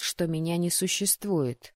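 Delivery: usual speaking voice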